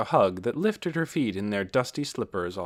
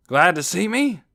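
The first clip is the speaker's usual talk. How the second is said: loud, raised, rising question; hearty big brother; 8 semitones higher, 8 dB louder than usual. A narrator's reading. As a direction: ask it boisterously of a little brother. Big brother teases younger one.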